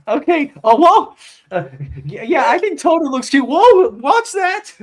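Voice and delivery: high, excited running commentary, slowing on the last warning; squealing hype voice cheering someone on; mock mover commentary. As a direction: play a giddy livestreamer filming the move. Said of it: "Be like".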